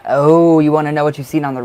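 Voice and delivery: loud, fast, eager realisation; excited. Real, not acted. Reading a viewer's question, suddenly eager. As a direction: burst out as it clicks.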